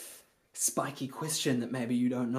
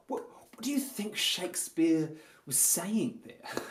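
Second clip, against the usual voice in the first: higher-pitched pondering question, put-on thoughtfulness; earnest intellectual musing; 5 semitones higher than usual. Actor asking the rehearsal circle about the text, mock pondering. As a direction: muse aloud as a pretend Shakespeare scholar. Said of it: "or you can say this too".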